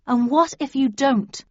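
pressing question, pitched a little up and firmer; persistent. A narrator's reading. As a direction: press the point insistently. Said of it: "I persisted".

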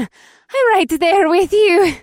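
high, slow, gushing with joy; drawn out, thrilled and gushing; gushing delight. Acted. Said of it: "Faye gushed with delight".

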